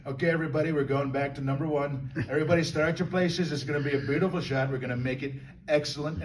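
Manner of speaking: rally the crew like Trump; hyping up the film crew as Trump; Trump impression. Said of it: "the Trump imitation".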